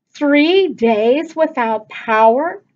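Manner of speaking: slow, loud dragged-out protest; groan. Acted. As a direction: groan the complaint out.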